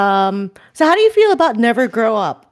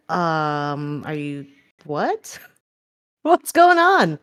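stunned slow confusion; 4 semitones lower, much slower than usual. Retold or imitated. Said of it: "then you told me".

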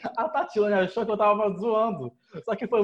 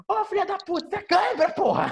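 mock gruff mother; 12 semitones higher than usual. Mother scolding her daughter over a cramp, mock high yell. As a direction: bark it like an impatient mom. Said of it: "o jeitinho dela carinhoso".

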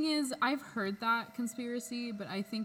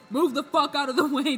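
loud, high mock order; parade-staff yell; 6 semitones higher, 10 dB louder than usual. Imitating staff clearing the parade route, mock shouting.

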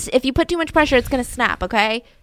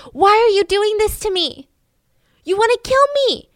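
much higher and louder, fast and panicked; terrified screaming; 10 semitones higher, 5 dB louder than usual. Retold or imitated. Delirious wife accusing her husband of murder, terrified scream.

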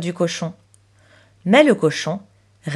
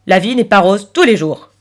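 loud, blunt pig character; matter-of-fact talking pig; 6 dB louder than usual. Acted. Piggy bank replying to Chloé's sigh, bluntly.

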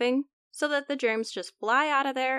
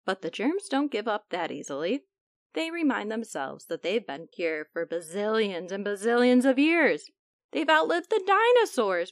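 ordinary delivery, nothing marked

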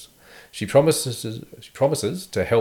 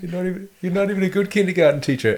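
high, fast, angry rant through a speaker; intercom rant; 9 semitones higher, 5 dB louder, faster than usual. Retold or imitated. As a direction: yell insults into the intercom. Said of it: "he's talking through the intercom".

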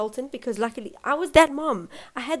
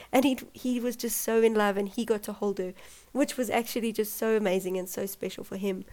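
usual speaking voice

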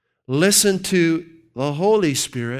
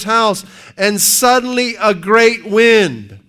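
preaching scripture; 6 semitones higher, 7 dB louder than usual. Acted. Preacher reading First Kings aloud at full force. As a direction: thunder out the verse.

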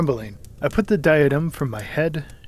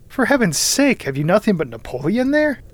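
exasperated; 7 semitones higher, 3 dB louder than usual. A narrator's reading. Cousin exasperated by books.

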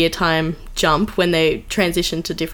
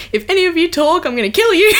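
mock threat; 8 semitones higher, faster than usual. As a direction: threaten the crowd in jest. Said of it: "And [name] said".

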